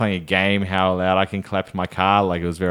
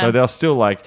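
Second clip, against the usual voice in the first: ordinary delivery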